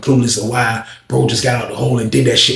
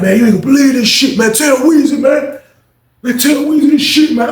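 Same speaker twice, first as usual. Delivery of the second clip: much higher, louder eager voice; can't wait to spill it; excited gossip; 12 semitones higher, 4 dB louder than usual. Retold or imitated. Dudes excited to tell.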